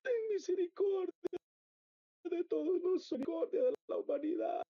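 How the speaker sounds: high, anguished plea for mercy; retold cry, pitched well up. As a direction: beg God in a high, straining voice. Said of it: "Yo gritaba".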